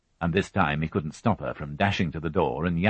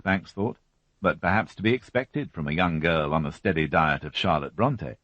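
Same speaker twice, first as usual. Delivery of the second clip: plain reading voice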